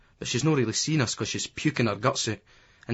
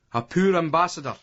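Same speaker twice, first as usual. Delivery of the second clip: higher-pitched, mildly roared jibe; 6 semitones higher than usual. A narrator's reading. Terry turning to the German guy, mild roar. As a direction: lightly roar the mock verdict. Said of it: "he roars".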